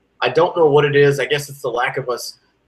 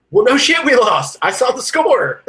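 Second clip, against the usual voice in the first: exasperated outburst; 10 semitones higher, 4 dB louder than usual. Real, not acted. Exasperated rant at negative fans.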